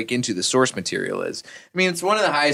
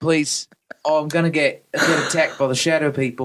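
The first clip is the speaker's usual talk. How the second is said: put-on a scared hobbit in a light New Zealand accent drifting to Australian; whimpering Kiwi hobbit, hammed up; mock New Zealand accent; 3 semitones higher than usual. Mocking a frightened Frodo in a put-on New Zealand accent. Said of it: "you started English and you started shifting Australian".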